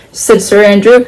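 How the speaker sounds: plain reading voice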